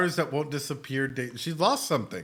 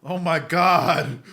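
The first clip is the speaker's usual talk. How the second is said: overwhelmed awe; 3 semitones higher, 7 dB louder, much slower than usual. Real, not acted. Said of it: "I'm getting all fucking emotional".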